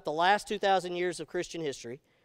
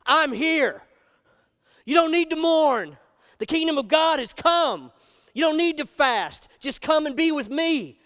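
raised, louder, slow proclamation; Jesus' reassurance; 5 semitones higher, 5 dB louder, slower than usual. Retold or imitated. Jesus telling disciples why they need not fast, proclaiming. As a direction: announce the good news with joy. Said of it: "Jesus says".

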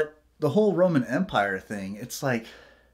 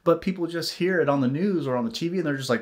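ordinary talk, nothing marked

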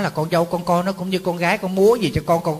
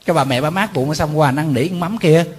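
normal talking voice

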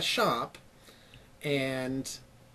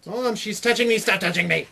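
put-on high, loud whiny kid tattling; whiny tattling little sibling; 5 semitones higher, 5 dB louder than usual. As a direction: tattle to Mom like a bratty kid. Tattling to mom.

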